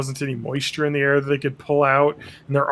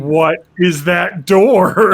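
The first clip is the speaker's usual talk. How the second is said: slow, loud, stunned question; mock alarm; 3 semitones higher, 7 dB louder, much slower than usual. Viewer spotting a hidden door, slow loud alarm. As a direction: drag it out in disbelief.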